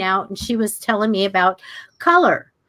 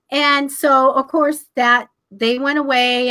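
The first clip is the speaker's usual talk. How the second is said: ordinary talk, nothing marked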